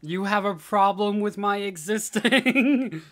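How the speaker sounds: slow, loud, drawn-out diva line with laughter; sassy comeback. Pretend. Izuku, played as a diva, brushes off the classmate, slow and loud. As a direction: draw it out like a proud diva, laughing through it.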